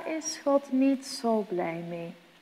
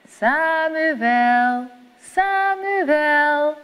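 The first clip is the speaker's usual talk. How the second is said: louder, higher drawn-out call; God calling loudly; 6 semitones higher, 6 dB louder, much slower than usual. A narrator's reading. God calls Samuel a fourth time, louder. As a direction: ring out the name like a divine summons. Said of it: "dan hoort hij".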